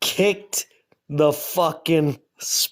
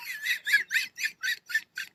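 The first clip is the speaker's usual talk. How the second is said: squealing high laugh; 9 dB quieter than usual. Real, not acted. Laughs at own joke.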